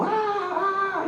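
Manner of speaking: high pained "¡Ah, ah!" cries, far above the pastor's voice; soft, high whimpering cries of a hurting girl; child's cries of pain. Retold or imitated. Pastor imitating the sick girl crying out in pain, high and soft.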